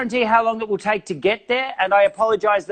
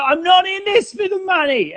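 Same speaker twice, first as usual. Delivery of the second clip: mock lofty; 12 semitones higher than usual. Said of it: "And I go".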